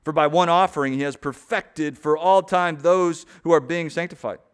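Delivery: loud, ringing scripture reading to the congregation; forceful preaching. Acted. Pastor proclaims Hebrews to his church, loud and ringing. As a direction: preach the verses out full-voiced, driving each line.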